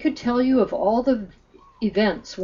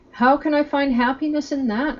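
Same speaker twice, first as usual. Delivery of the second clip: higher-pitched, mildly defiant question; a touch of defiance in the narrator's reading; a little defiant; 6 semitones higher, 4 dB louder than usual. A narrator's reading. Arif challenging the others over the man's choice, mildly defiant. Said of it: "asked a little too defiantly".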